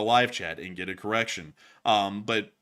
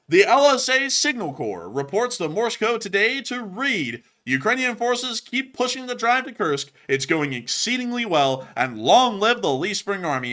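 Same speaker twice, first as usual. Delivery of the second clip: high, loud, booming announcement; announcer read; 10 semitones higher, 7 dB louder than usual. Acted. Host reading the decoded Morse message to viewers, loud announcer read. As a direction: belt out the message like a proud announcer.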